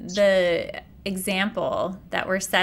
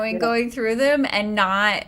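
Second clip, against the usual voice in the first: ordinary talk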